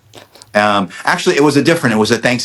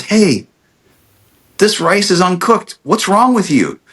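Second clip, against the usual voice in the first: higher-pitched imitation of mom scolding the host; playfully rip into the host, pitched up; mock scolding; 9 semitones higher than usual. Mom scolding the host over raw rice, mock indignant and higher. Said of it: "starts to kinda rip into her".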